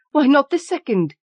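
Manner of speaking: high, loud feverish question; old woman pleading feverishly for the wish. A narrator's reading. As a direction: push the question with fevered hope. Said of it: "said the old woman feverishly".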